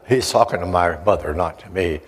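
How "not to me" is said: normal talking voice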